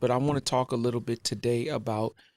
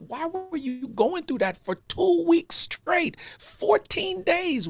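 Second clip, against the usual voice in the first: much higher and slow, disbelieving; exasperated plea; slower than usual. Retold or imitated. Husband asks wife why she hid it, higher slow pleading. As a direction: stretch out the hurt question. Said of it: "but I also feel like".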